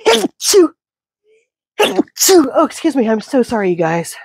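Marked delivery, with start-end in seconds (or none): 0.1-2.5 loud high sneezes; two sharp real sneezes. Real, not acted. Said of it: "I'm going to sneeze".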